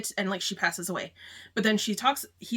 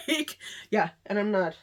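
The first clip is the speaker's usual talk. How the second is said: teary laugh. Real, not acted. Vlogger laughing through tears about book two. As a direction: laugh it off while welling up. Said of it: "I'm crying".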